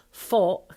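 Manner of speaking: "fought" cut off before its final t; loud, drawn-out FOUGH' with no t; dropped final T. Shown as a demonstration. Coach correcting the actor's word, dropped final T. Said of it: "there's no final T".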